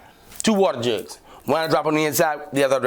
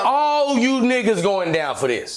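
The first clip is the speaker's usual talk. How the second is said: gloating ooh; 10 semitones higher than usual. Retold or imitated. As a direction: crow over the cops. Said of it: "I'm talking shit now".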